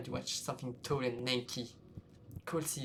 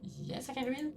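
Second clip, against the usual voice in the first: much higher timid reply; nervous answer; 12 semitones higher than usual. Acted. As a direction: answer quickly, eager to please. Player answers ghost.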